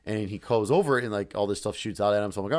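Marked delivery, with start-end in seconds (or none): none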